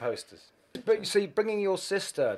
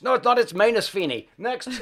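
higher and louder, a brisk teacher overruling the name; strict Irish teacher; 8 semitones higher, 6 dB louder than usual. Retold or imitated. Irish schoolteacher renaming a new boy, brusque and loud. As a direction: snap the name right and move on. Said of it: "they said".

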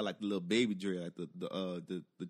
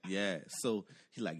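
normal talking voice

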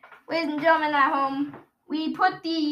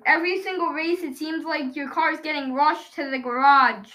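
usual speaking voice

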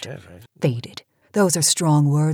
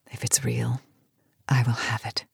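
scheming whisper; 4 semitones lower, 6 dB quieter than usual. Acted. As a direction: whisper "If it's real, I will have it" low and greedy. Janice alone, scheming over a cure.

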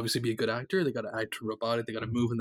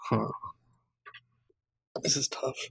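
whispering. Real, not acted. Pondering a hard casting pick.

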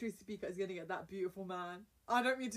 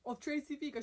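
ordinary talk, nothing marked

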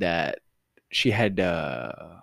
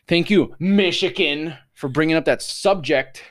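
higher, louder, slow mock gratitude; sarcastic thanks; 7 semitones higher, 5 dB louder than usual. Real, not acted. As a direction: thank them with heavy irony. Mock thanks after absurd law.

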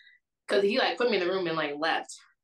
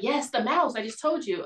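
imitated exasperated reply, higher and louder; impatient insistence; 6 semitones higher, 5 dB louder than usual. Date answering her confusion, mock impatience. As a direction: mimic someone tired of explaining.

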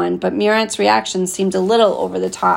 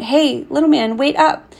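raised, higher call after someone leaving; 6 semitones higher than usual. A narrator's reading. Murat calling after the departing boy, raised voice. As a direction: call out to stop him walking away. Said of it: "I heard him call out".

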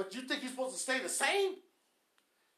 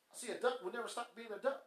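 ordinary talk

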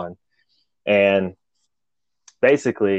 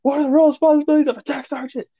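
high, rapid-fire yelled demand; retold yell; 3 dB louder, faster than usual. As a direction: imitate a chief yelling, pitch way up, rushed. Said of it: "he yells at him again".

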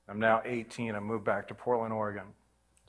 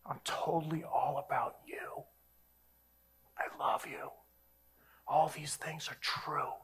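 much softer, whispered and tender; God's gentle whisper; 13 dB quieter than usual. Retold or imitated. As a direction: whisper it like God speaking close. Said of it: "I sensed God saying".

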